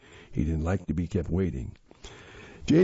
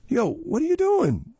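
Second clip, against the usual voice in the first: high-pitched angry challenge. A narrator's reading. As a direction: demand it angrily, pitch jumping up. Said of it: "Jaden said angrily".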